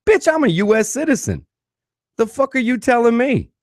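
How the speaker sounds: high, loud indignant rant; shrieking high pretend outburst; imagined outraged yell. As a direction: blow up at her in your head. Imagined furious retort.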